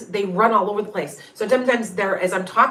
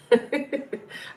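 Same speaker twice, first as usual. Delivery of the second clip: a quick higher chuckle; short laugh; 7 semitones higher than usual. Real, not acted. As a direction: laugh off the joke. Laughs at the cat's rule.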